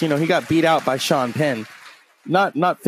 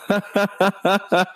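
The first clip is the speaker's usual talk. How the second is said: laugh; 6 semitones higher than usual. Real, not acted. Laughs at his movie mistake.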